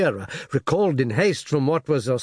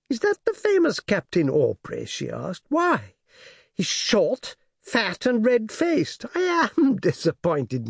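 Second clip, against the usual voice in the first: a hint of a pert little girl, higher; girl peering through the glass, let down; lightly voiced young girl; 7 semitones higher, slower than usual. A narrator's reading. Daughter judges the famous captain to her father, lightly girlish.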